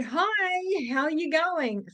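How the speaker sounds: high, loud put-on cheeriness; fake happy. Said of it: "Fake smile, but the words sounded, you know, happy".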